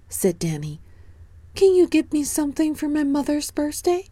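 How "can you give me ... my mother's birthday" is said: put-on bright, much higher little boy voice; high child voice. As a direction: speak up high like an eager small boy. Danny asks the bear.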